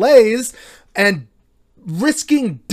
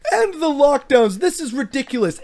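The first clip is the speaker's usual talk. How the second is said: a mocking, laughing imitation of a whining protester, pitched higher; mocking whiny protester; 6 semitones higher than usual. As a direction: lampoon them with a laugh in the voice. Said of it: "the ones to turn around and say".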